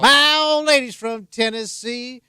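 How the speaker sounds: slow, high, loud put-on voice; hammy mock drawl. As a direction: ham it up like a cranky local. Hammy repeat of own line.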